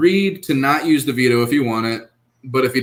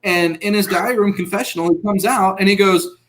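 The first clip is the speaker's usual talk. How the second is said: ordinary delivery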